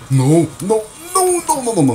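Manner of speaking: high, loud string of no's; panicked yelling. Real, not acted. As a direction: yell in real panic. About to die again.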